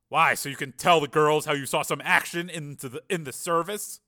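army sergeant mocking a civilian; scornful sergeant. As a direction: needle the would-be soldier. Narrator voices scornful sergeant.

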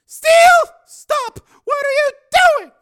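very high, loud female shout; alarmed cry. Acted. Susan as Steele tears the grill down, alarmed. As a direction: cry out to stop him. Susan cries out to stop him.

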